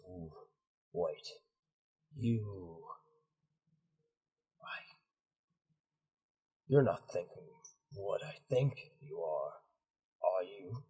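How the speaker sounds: low, quiet, halting disbelief; understated dawning suspicion from a commander; bewildered, suspicious. Acted. Bewildered commander questions his right hand.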